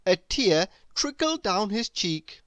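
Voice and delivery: ordinary delivery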